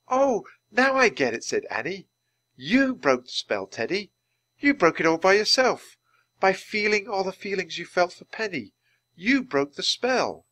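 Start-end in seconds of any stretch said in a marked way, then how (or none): 0.1-1.4 high, loud burst of understanding; excited realization. Acted. Annie suddenly understands the rhyme, excited. 2.5-10.4 high, emphatic excitement; delighted revelation. Acted. Annie tells Teddy he broke the spell, excited.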